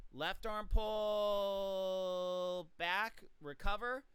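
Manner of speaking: very slow, drawn-out coaching cues; slow drill cues. Shown as a demonstration. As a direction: stretch each cue out like a slow demo. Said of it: "So you do that with the left arm".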